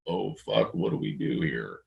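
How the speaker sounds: a low, hushed oh fuck under the breath; low muttered alarm. Retold or imitated. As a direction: mutter it low like a worried engineer. Engineer's alarmed reaction, quoted.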